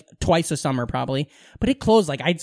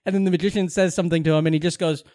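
ordinary delivery

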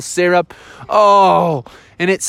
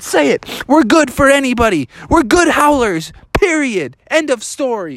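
higher-pitched, emphatic bear cub ultimatum; insistent demand; 9 semitones higher than usual. Acted. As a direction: lay down the law, phrase by phrase. Bears pin wolf, demand admission.

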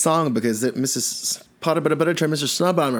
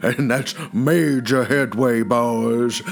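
a lower, put-on character voice talking to the boys; lower wedding guest voice; 3 semitones lower than usual. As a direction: play a gossipy guest in a low voice.

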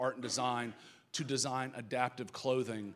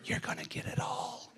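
whispered. Retold or imitated. Pastor whispering a promise to the young girl. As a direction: breathe it to her like a promise. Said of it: "I looked at her and whispered".

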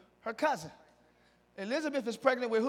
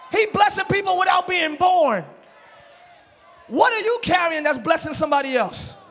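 loud, higher shouted preaching; preaching at full force; 5 semitones higher, 8 dB louder than usual. Acted. Pastor stirring the congregation, shouting excitedly. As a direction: whoop it up for the church.

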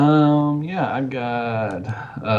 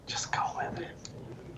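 hushed unvoiced whisper off mic; 15 dB quieter, slower than usual. Real, not acted. Co-host whispering to the others before the show. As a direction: whisper it so only the host hears.

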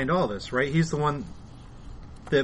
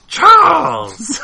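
high, loud hammed-up shout of the name; mock yell; 12 dB louder than usual. Imitating Juggernaut seeing Charles, high loud mock shout. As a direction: bellow it like a panicked brute. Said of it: "Juggernaut's like".

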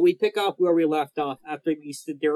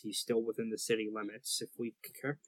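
low, quick muttering aside; under his breath; 5 semitones lower, 6 dB quieter, faster than usual. Acted. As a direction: mutter it to yourself. GM voices NPC under his breath.

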